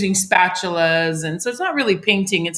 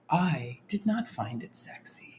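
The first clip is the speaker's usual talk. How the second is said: whispering; 4 semitones lower, 13 dB quieter than usual. Retold or imitated. Mocking Bob Ross soft voice.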